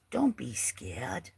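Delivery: slow comforting whisper in a put-on voice; mock soothing whisper. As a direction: hush yourself, pretending to calm a scared kid. Answers her own mock fear.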